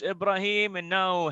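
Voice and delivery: usual speaking voice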